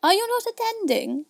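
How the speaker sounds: very high, scolding mouse; severe. A narrator's reading. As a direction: scold sharply in a high-pitched voice. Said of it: "said the mouse to Alice severely".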